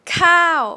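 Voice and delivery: "cow" high and loud, then falling. Shown as a demonstration. Teacher models the Thai falling tone, high dropping "cow". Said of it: "falling tone".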